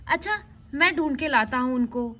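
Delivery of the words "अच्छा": ordinary delivery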